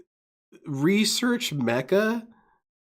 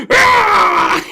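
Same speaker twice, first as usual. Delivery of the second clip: sudden much higher, louder dinosaur screech; imitated roar; 9 dB louder than usual. Streamer imitating Phil's roar, loud raptor screech. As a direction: shriek it like a velociraptor. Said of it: "when he goes".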